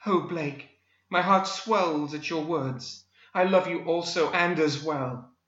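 high, slow lovestruck damsel; swooning heroine Daphne. A narrator's reading. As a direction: gush like a melodramatic romance heroine.

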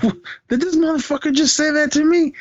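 high, incredulous "did he really just say that"; retold, half-laughing disbelief at an insult; stunned disbelief. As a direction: squeak it out in shocked disbelief. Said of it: "it made me laugh 'cause I'm like".

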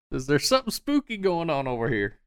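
high-pitched nervous henchman; spooked henchman. Acted. Spooked men answering their boss: high nervous voice. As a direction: pitch it up like a jumpy lackey.

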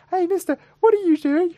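put-on high, child's voice, curious; small kid. Little boy on the beach asking the sound man, high child voice. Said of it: "this small kid came up and was like".

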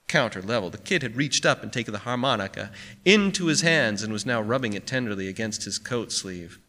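usual speaking voice

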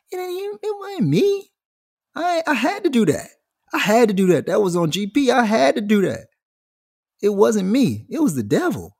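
put-on high, squeaky protesting kid voice; whiny kid excuse. Kid protesting to the teacher, high whiny excuse. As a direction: plead innocence like a guilty child.

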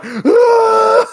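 high shrieked mock scream. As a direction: shriek like a terrified kid. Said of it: "little Blaze was like".

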